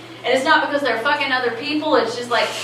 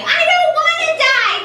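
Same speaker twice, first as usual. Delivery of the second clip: put-on much higher, louder child's panicked scream; 4 dB louder than usual. Little kid in the waiting room screaming in terror, much higher. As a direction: shriek it like a terrified little kid. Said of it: "the little kid screaming".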